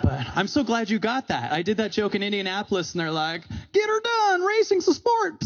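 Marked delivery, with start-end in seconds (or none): 3.7-5.4 very high-pitched hollered caricature; mock racing fan. Comic imitates his Indianapolis audience, in a high mock holler.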